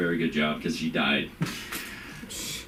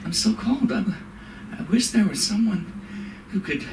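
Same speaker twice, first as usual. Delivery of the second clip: put-on high, plaintive old-lady whine; whiny old mother; 12 semitones higher than usual.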